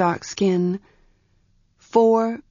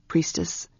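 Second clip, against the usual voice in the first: deepening voice; 2 semitones lower, 6 dB quieter than usual. A narrator's reading. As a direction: sink gently on the address. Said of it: "his voice deepening".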